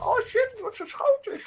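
mock desperate high squeal; desperate enemy. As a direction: squeal like a panicked player. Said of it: "Hij was echt zo heel desperate van". (wanhopige piepstem)

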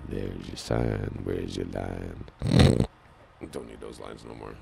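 deep mock voice. Joking bit after laugh.

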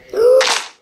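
loud high gasp; excited gasp. Real, not acted. Vlogger gasps before telling viewers news. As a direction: gasp like remembering something big.

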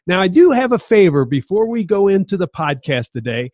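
loud, upbeat podcast welcome; energetic host opener. Acted. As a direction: pump up the listeners like a radio host.

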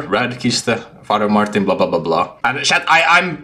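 normal talking voice

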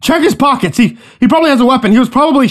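high, hurried, stammering insistence; frantic blathering. A narrator's reading. Owen insists the wounded man is armed, frantic blathering. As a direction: keep babbling till cut off. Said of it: "Owen was still blathering".

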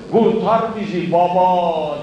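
slow, drawn-out mock plea of a villager. Villagers calling on Demirel to save them, a mild mock plea. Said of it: "diye bağırıyor".

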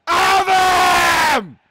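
very high, very loud holler; urgent yell. Real, not acted. Gamer urging a tackle in the match, urgent yell. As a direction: scream the order at a player.

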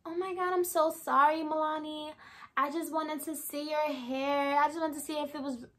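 high-pitched mimicked apology; imitating Emily. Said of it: "This is how she talks".

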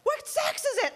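very high-pitched frantic questioning; mock everyone going crazy to find out; hammed-up, squealing demand; crazed nosy crowd. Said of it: "That drives everyone crazy".

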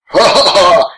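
ordinary delivery

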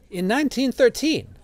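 high-pitched character answer; old man Drioli. A narrator's reading. Old man answering the crowd, high character voice. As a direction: lift the voice far above narration.

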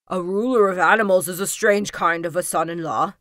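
loud, imposing king's voice; sceptical king. A narrator's reading. King doubting the proposed son-in-law, loud and sceptical. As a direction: pronounce it loudly as a doubtful monarch.

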